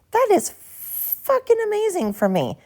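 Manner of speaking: high, squealed delight over the numbers; squealing excitement. Real, not acted. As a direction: squeal it out in pure joy. Thrilled about download numbers.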